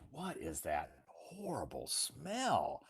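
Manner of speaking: slow, hushed and appalled; whispered question. Retold or imitated. Visitor asking a Texan about the smell, whispering. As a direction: whisper it as if the stink is shocking. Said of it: "if you ask somebody from Texas".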